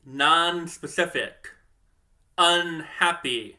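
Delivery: slow, each example word set apart. Shown as a demonstration. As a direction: say "non-specific" and "unhappy" slowly, one by one. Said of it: "for example".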